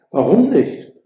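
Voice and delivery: put-on a puzzled why not from the young lover; astonished. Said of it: "fragt Romeo erstaunt". (erstaunt und lauter, höher gefragt)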